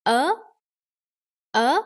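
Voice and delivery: UH pushed sharply upward; rising tone. Shown as a demonstration. Teacher tells learners how to say it, UH rising. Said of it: "rising tone over that".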